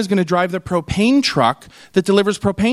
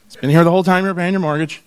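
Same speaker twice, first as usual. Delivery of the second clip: ordinary talk, nothing marked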